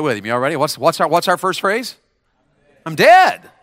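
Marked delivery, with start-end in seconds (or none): 2.8-3.5 high, loud rallying shout; loud call for the crowd to echo. Shown as a demonstration. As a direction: shout it to get them going. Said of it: "Say it".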